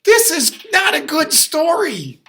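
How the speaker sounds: high, loud, slow hammed-up protest; mock outraged shout. Dad shouting in mock outrage at the story. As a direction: cry out in mock alarm.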